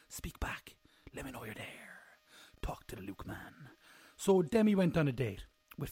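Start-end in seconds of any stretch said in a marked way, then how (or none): 0.1-3.7 whispered plea to the audience. Acted. Vlogger whispering to viewers to talk back. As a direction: breathe it, begging them to answer. Pleading softly to viewers to reply.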